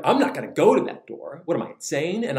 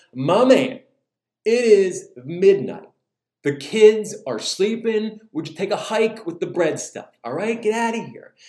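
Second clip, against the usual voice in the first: higher, loud, exasperated brush-off; annoyed neighbor; 5 semitones higher, 5 dB louder than usual. Acted. Grumpy neighbor to caller while kids sleep, annoyed. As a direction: send him packing, irritated.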